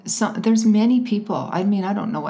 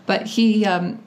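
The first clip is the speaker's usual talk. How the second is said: ordinary delivery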